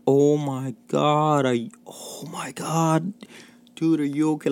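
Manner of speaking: high, slow, stunned oh my God; shocked friends. Retold or imitated. Friends gasping at the burning car, mock shocked. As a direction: play the friends gaping at the news.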